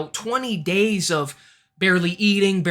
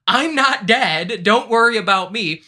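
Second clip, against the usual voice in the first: louder, drawn-out mock announcement; retold reassurance; 2 semitones higher, 5 dB louder than usual. Contestant coming back to the tribe, loud mock declaration. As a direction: declare it slowly and loudly, imitating him.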